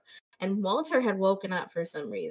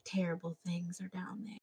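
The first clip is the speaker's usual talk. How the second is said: mock ominous hush; 12 dB quieter than usual. Retelling the woman's warning about the basement, hushed and ominous. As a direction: hush it like a dark secret. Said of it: "And she's like".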